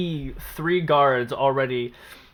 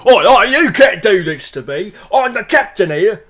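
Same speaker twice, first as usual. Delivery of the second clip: loud Cockney guard shouting; sentry challenge; 8 semitones higher, 12 dB louder than usual. Acted. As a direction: shout like a rough English guard. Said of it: "Fable Three guard voice".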